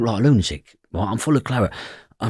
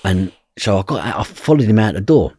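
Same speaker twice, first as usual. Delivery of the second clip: normal talking voice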